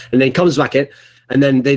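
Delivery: normal talking voice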